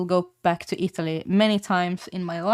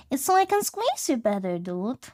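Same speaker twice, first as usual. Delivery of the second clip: put-on pitched way up, sing-song baby talk; high cooing pet voice; 3 semitones higher, 3 dB quieter than usual. Talking to a scrunchy pet, high baby talk.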